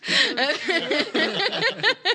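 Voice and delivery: high, loud burst of laughter; cracking up at the table; loud laughing fit. Real, not acted.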